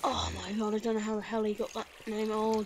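ordinary delivery

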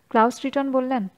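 ordinary delivery, nothing marked

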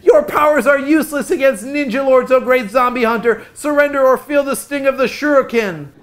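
loud, high, grandiose challenge; mock-heroic proclamation of doom. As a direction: declaim it like a hammed-up rock-star warlord. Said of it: "in a most excellent Mick impression".

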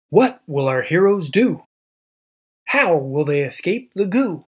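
usual speaking voice

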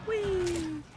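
high, drawn-out squeal; playful whee. Real, not acted. Player enjoying the ride, playful squeal. Sliding or falling in game.

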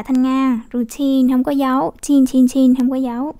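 usual speaking voice

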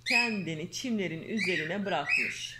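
lively storybook reading voice; reading to children. Acted. Mother reading a fairy tale to her daughter, lively storyteller. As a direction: read the tale aloud to a little girl.

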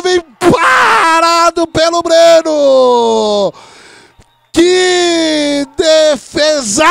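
roaring, stretched-out live call; excited play call. Real, not acted. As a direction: shout the big play as it happens. Blocked field goal, live call.

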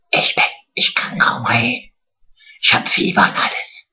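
husky, voiceless, put-on sick croak; faked sick voice. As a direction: play sick, barely any voice. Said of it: "so getan, als wär ich erkältet". (gespielt heiser und kränklich, fast ohne Stimme)

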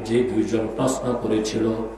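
ordinary delivery

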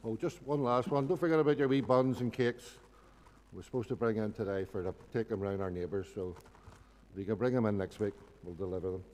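ordinary delivery

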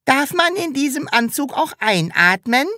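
high-pitched innocent question; feigned innocence. A narrator's reading. Sams asks the seller about the suit, feigned innocence. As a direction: ask sweetly in a high little voice, playing innocent. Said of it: "fragte es scheinheilig".

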